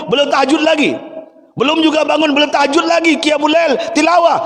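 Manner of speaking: high, fervent preaching cry; rising sermon fervour; impassioned preaching. Acted. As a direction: preach it at full force, climbing high. Preacher retells the Prophet's night.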